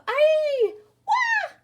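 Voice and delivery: put-on high, loud childish wail of hurt; a child's pained ai then a big wah; crying out in pain. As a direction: yelp then wail like a hurt little kid. Sounds of kids getting hurt.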